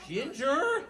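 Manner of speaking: loud, high, rising call; sharper scolding grandma. Acted. Grandma looks at the dog again, scolding high and loud. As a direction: call the dog's name with a steep rise.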